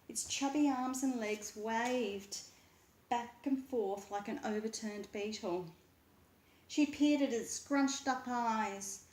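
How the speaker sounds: ordinary delivery, nothing marked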